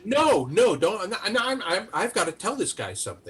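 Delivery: high protesting voice; insisting she must speak; frantic witness. Retold or imitated. Witness pushing past Giuliani, high and frantic. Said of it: "She's going".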